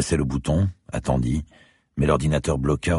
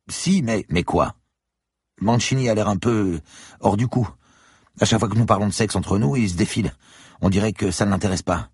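put-on higher, young student's voice; 8 semitones higher than usual. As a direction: voice the eager student. Student character voice. (voix d'étudiant plus aiguë)

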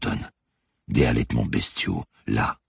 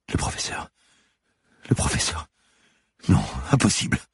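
stunned, voiceless murmur, broken by pauses; prostrate, muttering in disbelief; dazed repeating; 4 semitones higher than usual. A narrator's reading. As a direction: repeat it to yourself in shock. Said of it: "Elle resta prostrée et se mit à répéter".

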